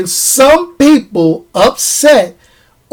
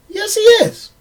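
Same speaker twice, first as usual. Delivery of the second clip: high-pitched concession; 9 semitones higher than usual. Real, not acted. Conceding the fans' point in high voice.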